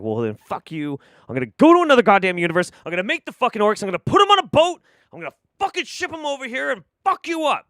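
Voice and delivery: furious rant climbing to a high-pitched, fast yell; hammed-up enraged god, building to shouting; mad Zeus. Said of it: "because he was mad".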